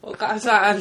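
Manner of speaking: 高い大声で子供のふりをして叫ぶ; 夜泣きに便乗して大げさに叫ぶ; ふざけて母親を呼ぶ. Pretend. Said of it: "トメさんも乗っかんないですか？". (ふざけた子供声で絶叫)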